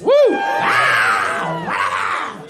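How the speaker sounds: big drawn-out hollering whoop; loud whoop. Shown as a demonstration. Comic trying to start a catchphrase, whooping loudly. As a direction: holler it like a rallying cry.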